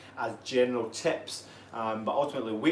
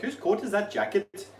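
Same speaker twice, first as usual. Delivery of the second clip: higher Welsh lilt on a silly saying; playful Welsh accent, pitched up; 8 semitones higher than usual. Retold or imitated. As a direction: put on a light Welsh accent. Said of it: "Welsh sayings, it's like".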